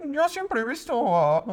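high, slow goofy dog voice; a silly golden retriever, laughing. Acted. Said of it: "Cuál sería tu voz de Golden Retriever".

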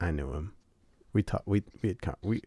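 low, quiet, choked-up; fake grief. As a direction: pretend to be too upset to finish. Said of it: "Say, like".

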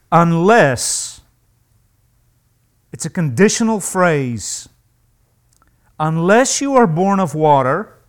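loud, insistent preaching; full-voiced, pressing emphasis; emphatic. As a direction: drive every word home. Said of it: "He's very emphatic here".

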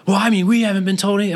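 high-pitched mock complaint; complaining daughter.

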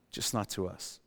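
soft echo. Acted. Echoed softly for effect.

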